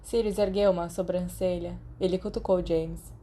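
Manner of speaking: ordinary delivery, nothing marked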